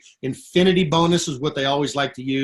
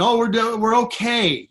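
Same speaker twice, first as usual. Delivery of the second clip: higher, timid mock voice of a struggling leader; mock meek voice; 6 semitones higher, slower than usual. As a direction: make fun of their limp answer, a little laughing. Mocking struggling leaders, laughs.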